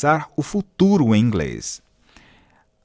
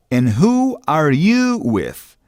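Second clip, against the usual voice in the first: slow model phrase; 2 semitones higher, slower than usual. Shown as a demonstration. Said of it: "Perceba que no inglês".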